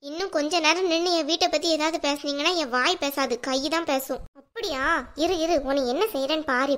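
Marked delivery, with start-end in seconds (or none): none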